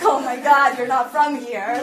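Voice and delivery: loud, laughing mock shock; shocked Bostonian. Bostonian reacting to a neighbour's friendly hello, loud mock shock. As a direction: blurt it out like a stunned local.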